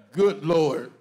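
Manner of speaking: ordinary talk, nothing marked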